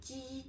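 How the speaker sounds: ji with a flat tongue, voiceless and soft; Mandarin ji. Shown as a demonstration. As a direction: Mandarin ji, lips flat, no voicing. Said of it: "in Chinese".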